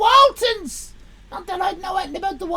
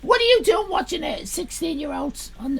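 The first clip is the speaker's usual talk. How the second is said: ordinary delivery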